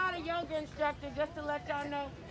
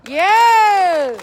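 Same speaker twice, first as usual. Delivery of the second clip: shouted yes; 20 dB louder than usual. Real, not acted. Rally roll call, crowd affirms.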